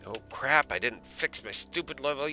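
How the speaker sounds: ordinary talk, nothing marked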